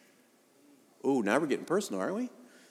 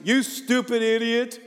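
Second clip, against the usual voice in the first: put-on high, loud, drawn-out little-kid yell; imitated high-pitched yell from the back seat; tiny kid yelling; 11 semitones higher, 5 dB louder, slower than usual. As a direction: mimic a small child hollering an insult. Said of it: "yells from the car seat".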